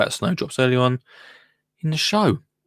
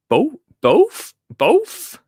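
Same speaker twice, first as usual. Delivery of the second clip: high, loud mock-Welsh accent with rising tune; badly faked Welsh, pitched right up; [name], mock Welsh; 14 semitones higher, 5 dB louder, much slower than usual. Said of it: "I feel like [name]'s Welsh".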